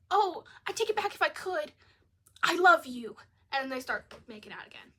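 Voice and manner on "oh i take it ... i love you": high, loud, hammed-up swoon; mocking the girlfriend's gushing plea. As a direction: overplay her sappy apology.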